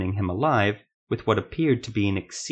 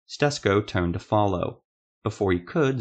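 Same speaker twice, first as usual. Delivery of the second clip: normal talking voice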